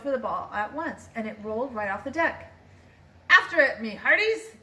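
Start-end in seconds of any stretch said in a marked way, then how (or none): 3.3-4.6 loud, higher order to the crew; pirate command. A narrator's reading. Captain ordering the crew after the ball, loud command. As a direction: bark it like a captain. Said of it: "Braid Beard commanded".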